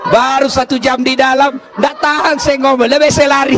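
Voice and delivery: high, loud, laughing complaint; the jinn cracking up as he flees. Acted. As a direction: jinn laughing while fleeing the nagging wife. Jinn fleeing the nagging wife.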